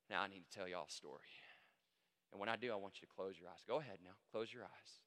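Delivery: hushed Southern storyteller voice; Southern lawyer. Acted. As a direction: charm the jury in a Southern accent. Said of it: "that Southern charm and that Southern accent".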